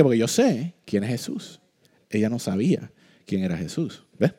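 usual speaking voice

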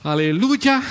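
loud, joyful cry of praise; shouted hallelujah. Real, not acted. Preacher celebrating heaven to the congregation, loud joyful shout. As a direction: throw the word up to the rafters.

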